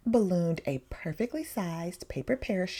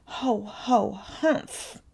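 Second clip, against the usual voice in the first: sigh; much slower than usual. A narrator's reading. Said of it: "sighed Tiny Reindeer".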